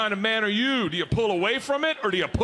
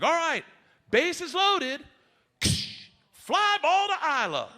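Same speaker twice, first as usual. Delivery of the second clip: high, projected field call; calling coach; 8 semitones higher, slower than usual. Retold or imitated. Softball coach to girls at practice, calling out the play. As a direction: call it out across a ball field.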